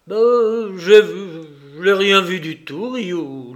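emphatic stumbling denial; flustered denial. A narrator's reading. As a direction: blurt it out, correct yourself, insist. Mayor's flustered denial to Ryu.

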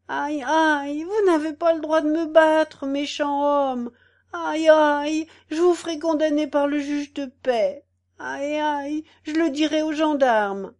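put-on high, loud wailing old woman; sobbing old woman. A narrator's reading. The beaten old woman wailing at the cat, high and loud. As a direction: cry out in pain and threaten. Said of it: "entre des soupirs et des sanglots".

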